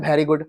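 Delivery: VERY said as "beri"; mispronounced very. Shown as a demonstration. Teacher showing what students usually say, mispronounced very. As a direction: put a b in place of the v in "very". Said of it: "हम लोग क्या use करते हैं".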